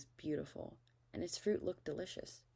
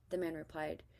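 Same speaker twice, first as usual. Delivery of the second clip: usual speaking voice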